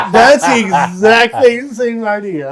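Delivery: laughing. Real, not acted. Cohost laughs over the story, talking through laughter.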